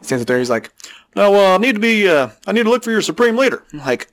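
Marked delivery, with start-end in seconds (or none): 1.2-3.6 alien voice. Pretend. Alien asks for leader.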